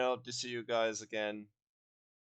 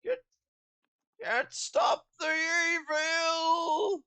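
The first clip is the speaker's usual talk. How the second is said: high, slow, straining villain shout; strained shout; 6 dB louder, much slower than usual. Pretend. Hammy villain cry, strained.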